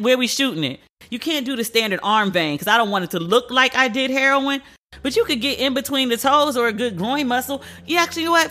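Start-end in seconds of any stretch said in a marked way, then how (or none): none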